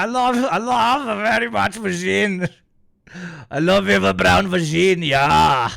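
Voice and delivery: put-on high, loud Borat-accented dictator; Borat Hitler. Streamer demonstrating his muddled dictator impression, loud Borat accent. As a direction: belt it out in a hammed-up Borat voice. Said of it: "I do Borat Hitler".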